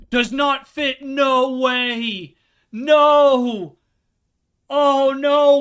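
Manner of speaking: high, loud cries of dismay as the key fails; real despair, loud and high; dismayed outcry. Real, not acted. Gamer crying out when the key won't fit the door.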